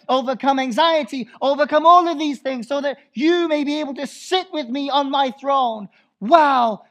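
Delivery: high, loud preaching cry; fervent pulpit voice; full-force preaching. Acted. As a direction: preach it out to the congregation.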